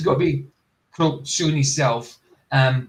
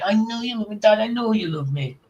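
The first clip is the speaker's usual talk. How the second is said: higher, sing-song mock of a sweet daughter; 7 semitones higher than usual. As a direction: parody the medium's syrupy daughter voice, pitched up. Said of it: "That's not how we spoke".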